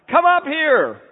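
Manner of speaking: put-on a kid yelling for his parents; child calling down. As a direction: call down the stairs like a small boy.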